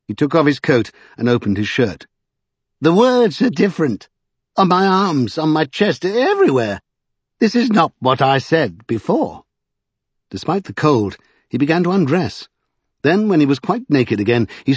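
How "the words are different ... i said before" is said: high-pitched gleeful eccentric; high, exultant character voice; gleeful prophet character. A narrator's reading. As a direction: play an odd, excited man in a high voice.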